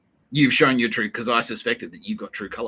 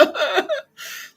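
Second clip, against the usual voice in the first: high giddy squeal of delight; mock lovestruck squeal; swooning squeal. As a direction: squeal like a smitten fan. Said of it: "they're like".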